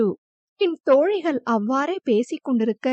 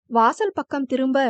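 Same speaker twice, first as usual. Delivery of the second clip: plain reading voice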